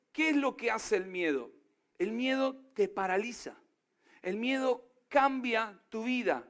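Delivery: usual speaking voice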